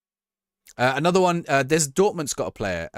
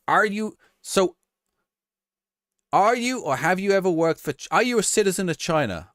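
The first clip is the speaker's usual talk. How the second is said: higher, louder, pompous questioning; mock senator interrogation; 8 semitones higher, 3 dB louder than usual. Streamer mimics a senator grilling the TikTok boss, pompous. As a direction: grill the witness like a self-important senator.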